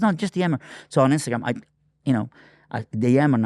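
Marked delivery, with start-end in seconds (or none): 0.0-0.6 wife's insistent push, voiced much higher; mock insistent wife. Wife urges husband while he shaves, mock insistent. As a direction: mimic his wife pressing him, higher pitched. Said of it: "she goes".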